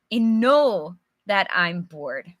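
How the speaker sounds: usual speaking voice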